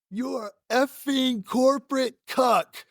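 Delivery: high, slow, deliberate mock insult; drawn-out hammed-up put-down; parting insult. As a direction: spell out the insult word by word. Retold final insult.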